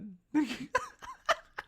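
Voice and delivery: a high, giggling burst; giggling at Robin's joke; high delighted laugh. Real, not acted.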